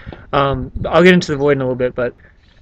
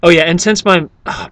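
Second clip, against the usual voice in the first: ordinary delivery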